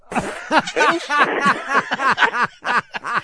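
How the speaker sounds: long, high peals of laughter; big real laugh. Real, not acted. Brothers recall their mother's art show, uproarious laughter. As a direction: crack up at length. Hosts crack up at story.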